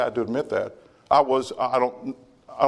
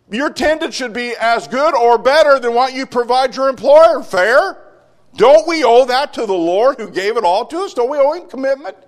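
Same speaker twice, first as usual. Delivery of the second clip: loud, high preaching; sermon at full force; fiery preaching; 13 semitones higher, 8 dB louder than usual. Acted. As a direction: press the congregation hard.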